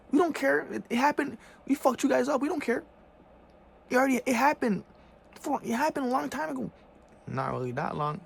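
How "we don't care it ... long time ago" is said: high, loud dismissive mock voice; sneering high parody. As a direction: play the uncaring oppressor, shrill and loud. Mocking the oppressors' dismissive voice.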